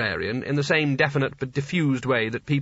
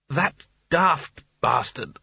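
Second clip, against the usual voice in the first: slow, drawn-out nasty jibe; nasty retort; much slower than usual. A narrator's reading. Shadowy figure answering who's that about Sconner, nastily. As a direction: stretch each word out spitefully. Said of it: "said the shadow nastily".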